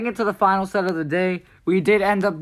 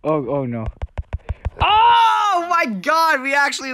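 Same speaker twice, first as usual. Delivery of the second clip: dismayed shout; 9 semitones higher, slower than usual. Real, not acted. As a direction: shout as the game slips away. Losing the round suddenly.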